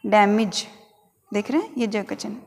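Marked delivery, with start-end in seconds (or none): 0.0-0.6 डैमेज ending in short "mij"; correct pronunciation. Shown as a demonstration. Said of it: "हम इसे किस प्रकार बोलेंगे".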